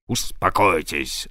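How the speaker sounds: low, slow stern command; calm him down with authority; captain sternly calming his mate. A narrator's reading. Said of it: "сурово сказал я".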